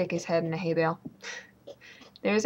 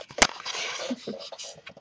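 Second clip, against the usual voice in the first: voiceless little laugh; breathy chuckle. Real, not acted. Laughs about the tired dog.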